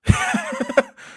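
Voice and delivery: very high-pitched giggling burst; burst of laughter. Real, not acted. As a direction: crack up at your own accent. Laughs at own bad accent.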